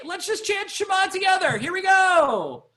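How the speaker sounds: high, ringing shout; rally everyone with a soaring call; excited rallying call. Real, not acted. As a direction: exuberant high call, stretched at the end. Rallying the class to chant.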